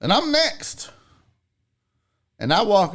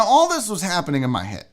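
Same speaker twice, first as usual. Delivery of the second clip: ordinary talk, nothing marked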